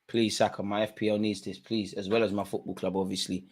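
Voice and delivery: soft pleading; nervous pleading. Real, not acted. Fan begging Saka before the penalty, soft nervous plea. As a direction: beg quietly under your breath.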